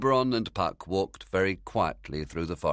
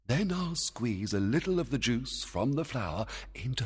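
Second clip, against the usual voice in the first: fairy king voice; 7 semitones higher than usual. Acted. Oberon plotting.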